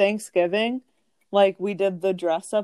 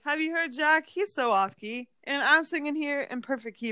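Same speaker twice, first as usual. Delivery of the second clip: retold indignant protest; 7 semitones higher, 4 dB quieter than usual. Kid singled out by the music teacher, mock indignant protest. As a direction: protest in a raised, softer pitch.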